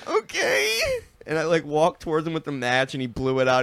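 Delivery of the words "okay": very high tearful whimper; crying kid. Retold or imitated. Scared boy obeying dad, high tearful voice. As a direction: squeak it out through tears. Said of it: "I was like".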